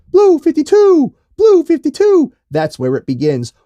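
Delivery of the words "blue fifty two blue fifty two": very high, loud, drawn-out shout; mock quarterback hollering a play; Waterboy football call. Podcaster quoting a football comedy, hollered play call. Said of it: "Speaking of water boy".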